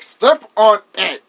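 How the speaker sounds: loud, slow, punched-out mock order; aggravated. Chucky ordering the driver, aggravated. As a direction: imitate an exasperated order, word by word. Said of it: "Chucky's just aggravated".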